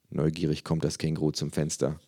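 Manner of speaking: plain reading voice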